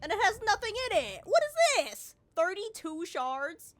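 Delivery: loud, high indignant yell; outraged shout. Real, not acted. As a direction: explode at the empty loot. Loot box empty, outraged.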